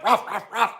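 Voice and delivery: soft, yappy imitated barking; dog barks. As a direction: bark like a little dog.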